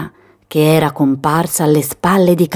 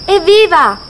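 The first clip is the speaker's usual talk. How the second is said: high, loud cry of freedom; little goat whooping with joy; joyful shout; 12 semitones higher, 4 dB louder than usual. Acted. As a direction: yell it out with glee. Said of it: "gridò la capretta".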